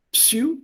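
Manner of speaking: "psy" with p and s run together. Shown as a demonstration. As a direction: sound both p and s at the start of "psy". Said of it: "You have to pronounce it together".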